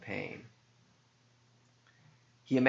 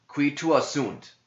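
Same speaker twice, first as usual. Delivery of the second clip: priest's challenge; 7 semitones higher, 5 dB louder, slower than usual. Acted. Priest's Latin exorcism question.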